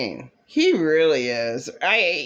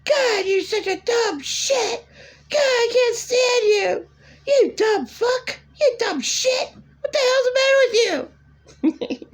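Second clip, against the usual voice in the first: very high, shrill tearful screeching with a laugh breaking through; screaming godmother. Retold or imitated. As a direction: screech insults at the driver, half crying. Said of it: "is she gonna scream at him more?".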